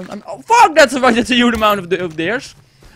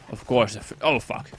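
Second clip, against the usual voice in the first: ordinary talk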